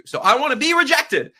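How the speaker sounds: "So I wanna be rejected" with a big lift on "be"; proudly insisting he wants rejection; emphatic. Real, not acted. Eager claim he wants rejection.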